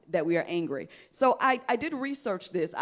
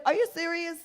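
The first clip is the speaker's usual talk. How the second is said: incredulous; 9 semitones higher than usual. Retold or imitated. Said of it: "You're like".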